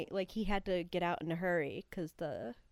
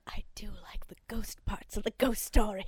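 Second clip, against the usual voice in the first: whispering; 3 dB louder than usual. Acted. Whispered reply.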